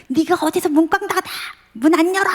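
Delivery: a parent yelling at a teen slamming the door, hammed-up fury; imitating a yelling parent. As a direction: rattle it off like an outraged parent slamming back. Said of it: "뭐 이러지 마시고".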